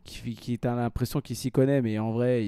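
ordinary delivery

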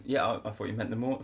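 normal talking voice

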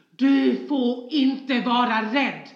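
high, very loud and slow bellowing; furious roar. Retold or imitated. A father roaring at his frightened daughter, furious bellow. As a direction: roar it as a command, full force. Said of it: "skriker det mest fruktansvärda avgrundsvrål".

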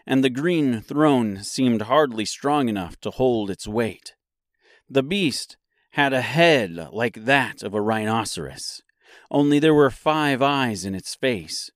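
usual speaking voice

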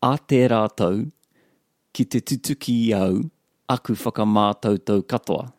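careful, unbroken reading; measured attempt at a tricky sentence; careful retry. Real, not acted. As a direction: get through each phrase deliberately. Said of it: "I'll try it again".

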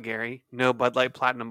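normal talking voice

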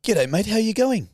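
a hint of a put-on caller; lightly disguised phone voice; fake caller voice. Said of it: "I'm being Paul from the Inquirer".

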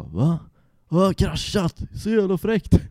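high, loud amazed disbelief; a delighted Va, jag har kraschat asked high and loud; impressed friend. Retold or imitated. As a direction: play the buddy who thinks a crash is cool. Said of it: "Han bara".

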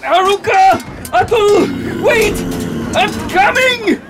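high, desperate calling out; alarmed high cry, stretched at the end; urgent rescue shout. Acted. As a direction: shout across a battle to friends. Prince shouts to endangered men.

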